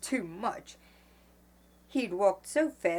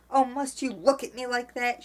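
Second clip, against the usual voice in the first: louder, higher irritated question; snapping; 6 semitones higher, 5 dB louder than usual. A narrator's reading. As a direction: snap at him impatiently. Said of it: "she snapped".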